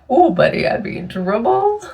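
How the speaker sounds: high, playful "oh buddy"; sing-song tease, laughing at herself; teasing warning. Real, not acted. Joking about smoking pot.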